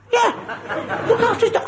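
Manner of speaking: high, laughing disbelief; comic outrage at dog-sitting; incredulous squeal. Retold or imitated. Incredulous retelling.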